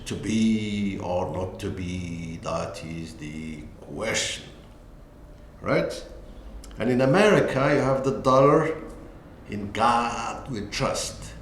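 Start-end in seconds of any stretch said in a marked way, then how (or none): 0.1-4.4 slow, lower, solemn Shakespeare quote; solemn recitation. Shown as a demonstration. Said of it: "Shakespeare with his famous quotation". 9.5-11.3 slow, careful American accent; American pronunciation. Shown as a demonstration. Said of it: "with the American pronunciation".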